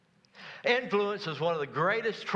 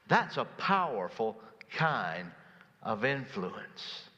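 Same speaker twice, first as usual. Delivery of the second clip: slow and deliberate, each word weighted; slow emphatic; 3 semitones lower, slower than usual. Real, not acted. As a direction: let it land slowly. Preacher slows for emphasis.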